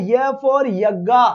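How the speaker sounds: य for यज्ञा spelled out very slowly; slow demo. Shown as a demonstration. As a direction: sound out the य slowly and carefully. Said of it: "इस यू का मतलब". (य को धीरे-धीरे उच्चारण करना)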